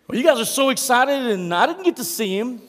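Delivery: high, loud, a touch of sulky whining; half-hearted hurt complaint; upset Thomas. Retold or imitated. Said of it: "He's a little upset".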